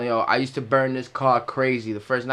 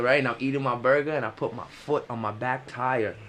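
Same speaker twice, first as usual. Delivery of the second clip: ordinary delivery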